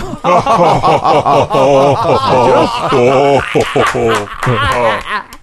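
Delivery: canned laugh track; crowd laughter. Shown as a demonstration. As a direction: play a sitcom laugh track. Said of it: "Mais je peux faire des rires enregistrés".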